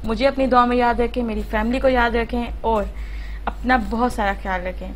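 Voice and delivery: ordinary talk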